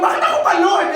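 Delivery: high-pitched whiny complaint to God; mock complaint. Preacher mocking a believer's complaint to God, high whine. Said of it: "Sabihin mo".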